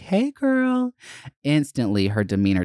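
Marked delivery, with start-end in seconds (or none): 0.0-0.9 put-on bright, high, sing-song "Hey, girl"; butter her up with a high friendly hello; a much higher, sugary girlfriend greeting; sassy greeting. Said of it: "And I was like".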